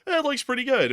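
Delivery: lightly voiced doctor; a hint of a casual doctor. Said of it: "the doctor said".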